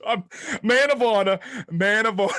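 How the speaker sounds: loud, high protest through laughter; laughing too hard to sound honorable; laughing insistence. Real, not acted. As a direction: insist loudly while cracking up. Cracking up while insisting on honor.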